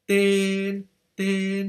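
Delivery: Ten held high and flat like a sung la; flat musical tone. Shown as a demonstration. Teacher shows the level tone of Ten to students. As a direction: sing Ten on one high level note. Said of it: "fa, sol, la".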